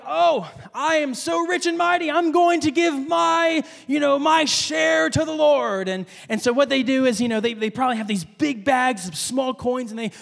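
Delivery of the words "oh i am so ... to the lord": high, haughty, pompous show-off voice; mocking rich man. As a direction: ham up a boastful rich giver. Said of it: "they're like".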